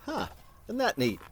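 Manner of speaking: plain reading voice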